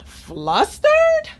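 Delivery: high, loud squeal on "Flustered"; a silly squeaky "Flustered"; squeaky high name. Acted.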